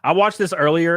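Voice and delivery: ordinary talk, nothing marked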